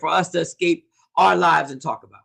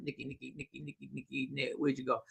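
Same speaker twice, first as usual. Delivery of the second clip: low, very soft muttering to oneself; muttered searching; 6 semitones lower, 18 dB quieter, faster than usual. Real, not acted. Host hunting for Nikki's comment, muttered searching. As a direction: mutter the name repeatedly under the breath.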